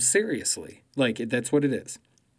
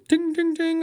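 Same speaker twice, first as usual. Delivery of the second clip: mock ring bell; 4 dB louder than usual.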